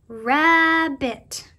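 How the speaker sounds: "rabbit" drawn out loudly in two parts, rab-bit; split into syllables. Shown as a demonstration. Teacher shows children two parts to that word, "rabbit" split in syllables. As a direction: stretch "rabbit" so both syllables stand out. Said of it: "you hear two syllables or two parts".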